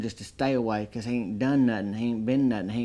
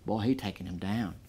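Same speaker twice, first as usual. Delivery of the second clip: normal talking voice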